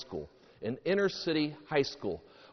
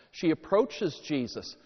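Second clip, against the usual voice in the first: ordinary talk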